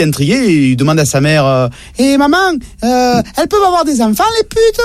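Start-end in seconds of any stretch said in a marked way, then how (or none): 2.0-4.8 put-on high little boy voice, far above his own; squeaky little boy asking his mum; child voice. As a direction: play the curious kid, pitched way up.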